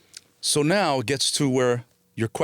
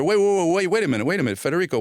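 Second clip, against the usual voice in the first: high, louder mock call to stop; urgent calling back; 6 semitones higher, 3 dB louder, faster than usual. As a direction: stop someone who is about to leave. Voices man stopping him leaving.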